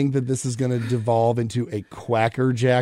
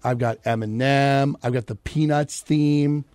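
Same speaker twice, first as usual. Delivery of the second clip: usual speaking voice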